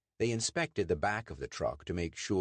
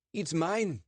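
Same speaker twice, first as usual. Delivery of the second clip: higher, quick defensive answer; nervous Sandeep; 11 semitones higher, 3 dB louder than usual. Acted. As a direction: claim the truck a little too fast.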